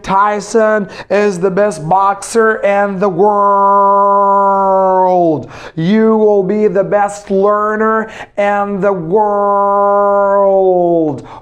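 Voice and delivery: slow, with stress on you; slow encouraging model sentence. Shown as a demonstration. Teacher encouraging the learners, slow and deliberate. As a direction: say it slowly to cheer them on. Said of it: "teacher encouraging students".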